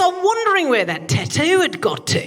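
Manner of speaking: high-pitched imitation of an Aussie comedian; high mock bloke voice. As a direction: squeak it out like a confused mate. Imitating Greg Fleet.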